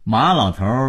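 ordinary delivery

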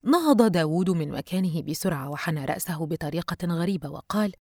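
usual speaking voice

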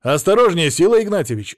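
loud, high, urgent call; shouted warning. A narrator's reading. Officer grabbing man at the window, shouted warning. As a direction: cry out to stop him. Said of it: "закричал Алексей".

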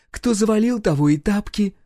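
high-pitched cheeky taunt; teasing. A narrator's reading. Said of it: "продолжала дразнить охотника". (задорно дразнить)

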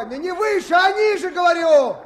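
correcting shout. Acted. Correcting misheard instruction across water.

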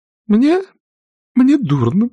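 high, fading faint-lady voice; a swooning old aunt; feeling faint. A narrator's reading. Aunt Pittypat swoons at the table, high and feeble.

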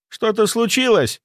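high-pitched worried question; alarmed. A narrator's reading. Woman calling after departing man, alarmed. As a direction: ask anxiously, voice jumping up. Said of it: "встревоженно спросила она".

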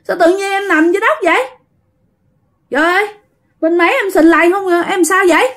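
put-on a lost young spirit waking up puzzled; a spirit speaking through [name], high and bewildered; possessed boy's voice. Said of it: "giọng [name] trở về bình thường".

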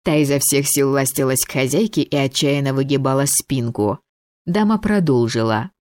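plain reading voice

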